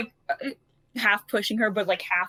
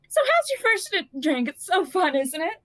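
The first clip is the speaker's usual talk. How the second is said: bright, higher sing-song party voice; lightly voiced changeling; 7 semitones higher than usual. Changeling teasing the gloomy noble, in a high chirpy voice. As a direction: needle her with high chirpy cheer.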